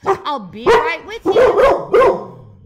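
very high, drawn-out shout; full, wobbling high-pitched yell; Mister Lemoncello shouting. A narrator's reading. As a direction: holler it down from a careening ladder. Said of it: "shouted Mister Lemoncello".

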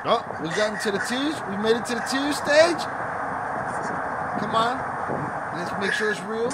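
loud, high triumphant outburst; gleeful excitement. Real, not acted. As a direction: crow it like a long-awaited win. Host spots man starting to cry.

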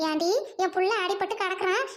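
normal talking voice

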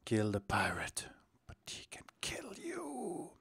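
very soft hushed whisper; secretive, barely audible whisper. Acted. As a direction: whisper it under your breath. Hushed aside on the level's title.

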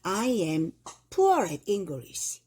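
I am poor at English, very slowly; slow example. Shown as a demonstration. Teacher reading example sentence, very slow. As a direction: stretch every word for the class. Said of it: "비록 내가 영어를 좋아하지만".

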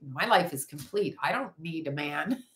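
ordinary talk, nothing marked

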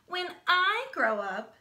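drawn-out proud announcement; braggy. Acted. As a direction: brag it out like she's the only one. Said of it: "She's such a braggy brown".

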